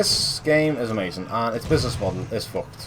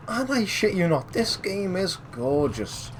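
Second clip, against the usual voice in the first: higher, slower, stressing it; excited emphasis; 9 semitones higher, slower than usual. Real, not acted. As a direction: really sell how gorgeous it is. Second claim, raised and slowed.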